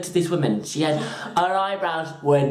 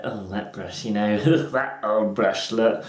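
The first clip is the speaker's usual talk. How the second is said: muttered disdain; 9 semitones lower, slower than usual. Retold or imitated. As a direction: grumble it low to yourself. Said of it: "I stopped and looked and was like".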